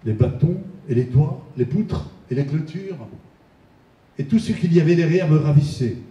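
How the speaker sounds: poem recitation. Acted. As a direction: recite the list item by item with pauses. Reads Chagall's poem aloud.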